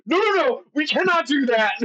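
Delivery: high, alarmed no-no-no; panicked young princes. Retold or imitated. Young princes protesting the Sabbat demand, high and alarmed. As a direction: squeal it out like frightened young princes. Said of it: "the young princes are like".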